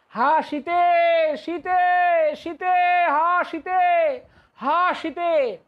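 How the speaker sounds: high, loud and slow wailing call for Sita; Ram's grief-stricken, drawn-out wail after Sita is taken; anguished cry. Acted. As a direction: cry out for her as if she is lost. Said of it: "চিৎকার করে ... কান্না করছেন".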